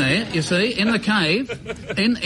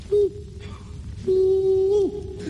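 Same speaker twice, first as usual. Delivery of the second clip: bunyip's reply call; 12 semitones higher than usual. Retold or imitated. Bunyip answering from the back of the cave, high hoot. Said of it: "You'll hear the bunyip at the back of the cave reply".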